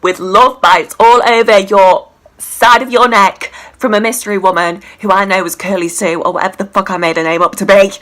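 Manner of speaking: loud furious telling-off; angry rant. Real, not acted. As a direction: tear into him.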